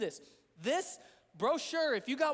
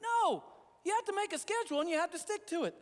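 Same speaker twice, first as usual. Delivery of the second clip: sharply higher, insistent command to the congregation; emphatic no; 7 semitones higher than usual. Real, not acted. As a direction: cut off the excuse and press the point hard. Pastor urges congregation emphatically.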